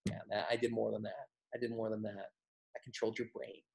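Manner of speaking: very soft, low private boast; knowing aside. Acted. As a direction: murmur it like a secret to yourself. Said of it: "and you will know".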